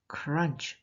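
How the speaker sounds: usual speaking voice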